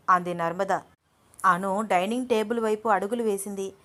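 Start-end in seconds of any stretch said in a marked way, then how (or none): none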